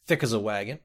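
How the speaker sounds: plain reading voice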